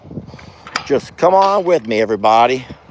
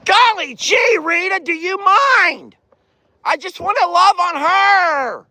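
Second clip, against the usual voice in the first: high exasperated shout. Real, not acted. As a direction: call out in mock exasperation. Pushed away by Rita.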